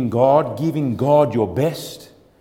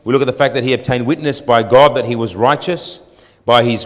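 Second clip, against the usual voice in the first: normal talking voice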